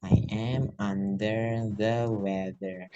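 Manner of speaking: very slow, each word stretched out; slow model phrase. Shown as a demonstration. Teacher showing learners the whole phrase, slowly stretched. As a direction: draw every word out for learners.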